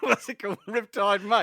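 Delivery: high, loud mock Australian; mimicked Australian accent. Quizmaster echoing the contestant's guess, mock Australian accent. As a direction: parrot a bad Australian accent, amused. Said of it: "My worst Australian accent ever".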